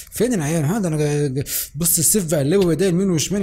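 ordinary talk

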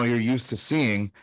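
normal talking voice

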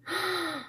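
sharp surprised intake; dramatic gasp. Real, not acted. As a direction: gasp in suspense. Reader gasps at storm.

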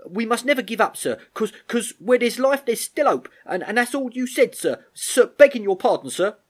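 high, loud, impassioned appeal; David speaking up. Acted. David urging the Major never to give up, high and fervent. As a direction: urge the officer on, tripping over the words. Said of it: "David spoke up now in support".

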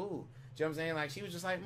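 ordinary talk

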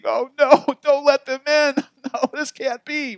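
high, hammed-up wail of protest; mock weeping. Preacher imagines a few members objecting, mock weeping. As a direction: pretend to cry out in dismay. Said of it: "they were weeping".

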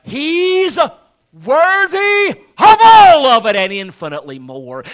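rousing preacher's cry; exultant shout. Real, not acted. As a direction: proclaim it at full force.

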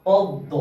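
পদ্ম said as pod-do; পদ্ম with the m silent, "poddo"; doubled দ. Shown as a demonstration. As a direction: land firmly on the double d of পদ্ম. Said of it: "দ এর উচ্চারণ দুবার হবে".

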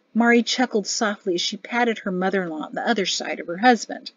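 ordinary delivery

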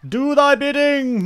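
high, loud, slow villain commands; mad scientist. Pretend. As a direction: order the land about like a mad genius. Commanding the terrain, then cackling.

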